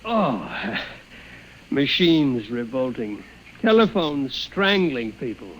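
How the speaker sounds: low, slow chuckling to himself; doctor alone, amused by his patient's story; amused chuckle. Acted. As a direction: shake your head and laugh.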